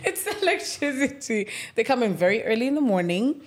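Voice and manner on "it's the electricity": higher-pitched giggly echo; laughing. Real, not acted. Echoing co-host's joke about missing earrings, laughing. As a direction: repeat it through a laugh.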